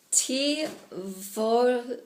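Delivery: very slow, word by word; hesitant, drawn-out attempt; halting recall. Real, not acted. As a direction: feel for a half-remembered foreign phrase. Struggling to recall an Italian phrase.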